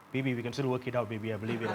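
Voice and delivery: low, mock-earnest begging; pleading ex. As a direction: plead low with an old flame. Voicing pleading ex at a wedding.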